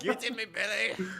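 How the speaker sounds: a cheeky Scottish quip; Scottish accent. Retold or imitated. Showing off a Scottish accent after friend's failed attempts. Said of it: "I used to be so good at accents".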